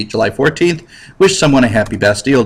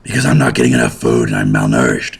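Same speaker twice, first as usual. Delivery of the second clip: much lower, fast, put-on starving voice; weak malnourished voice; faster than usual. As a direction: sound like you haven't eaten in weeks. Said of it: "He's like".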